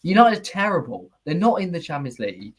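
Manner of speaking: ordinary talk, nothing marked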